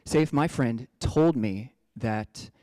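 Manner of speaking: ordinary talk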